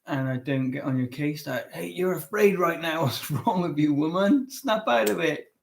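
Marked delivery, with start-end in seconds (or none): 1.7-5.5 hammed-up, higher-pitched scolding; exaggerated impatient telling-off; mock confrontation. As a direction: play at telling a partner off, pitch jumping up. Acted example of unhelpful confrontation.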